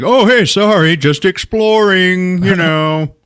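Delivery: high, loud, drawn-out sheepish excuse; mock casual apology. Dude caught snooping in her room, mock casual apology. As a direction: play the intruder stretching out a breezy apology.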